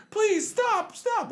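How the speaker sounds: very high mock plea; pleading wife. Voices the man's wife pleading.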